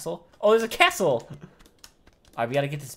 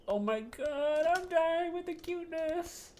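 squealing; 7 dB quieter, slower than usual. Real, not acted. Overwhelmed by cuteness.

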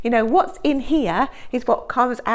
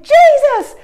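much higher, louder, slow desperate plea; frightened shout; 11 dB louder, slower than usual. Acted. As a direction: shout to wake him up. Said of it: "they shouted at Jesus".

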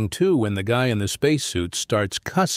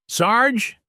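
calling out; 12 semitones higher than usual. A narrator's reading. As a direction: shout his name to find him. Calling out for the sheriff.

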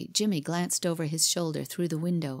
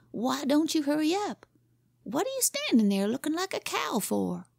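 put-on higher boyish voice, grand then impatient; boy playing pirate captain; 7 semitones higher than usual. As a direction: swagger like the Red Captain, then snap at the dawdler. Boy plays Red Captain.

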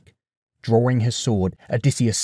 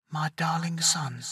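high, soft, thin ghostly mother; a distant tender ghost calling to her son; 6 semitones higher, 9 dB quieter than usual. Acted. Mother's shade greets her son, high and soft.

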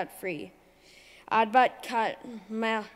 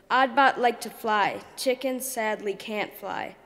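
ordinary delivery

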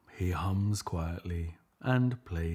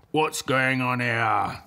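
louder lion challenge; growling lions; 4 semitones higher, 5 dB louder than usual. Acted. Pack of lions confront Leonard, louder growling lion voice. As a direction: growl the question like a fierce lion. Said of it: "they growled".

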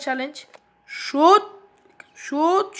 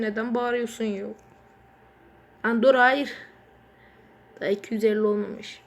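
ordinary delivery

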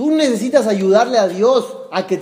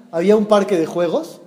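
ordinary delivery

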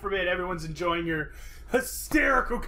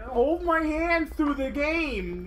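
high, drawn-out mock baby whine; whiny big baby; 8 semitones higher, much slower than usual. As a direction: plead like an overgrown baby, slow and high. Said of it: "I'm just a huge fucking baby".

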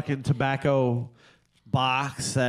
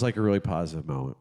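ordinary talk, nothing marked

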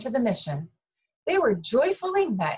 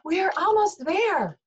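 excited; 12 semitones higher, 3 dB louder than usual. A narrator's reading. Ming Chu calls to parents.

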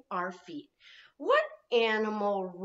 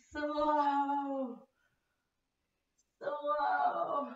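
drawn out; 4 semitones higher, 6 dB quieter than usual. Shown as a demonstration. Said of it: "And go slow".

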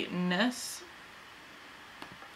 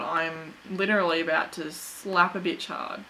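ordinary talk

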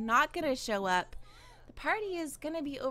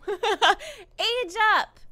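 loud, high, laughing shout; triumphant cheer; 7 semitones higher, 10 dB louder than usual. Real, not acted. Triumphant click after hunting the option.

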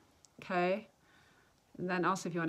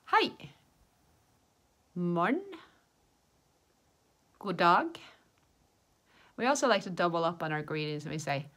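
language-teacher demonstration; 2 semitones higher, 8 dB louder than usual. Shown as a demonstration. Teacher showing learners ways of saying hi, projected demonstration. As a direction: call out each greeting for learners to copy.

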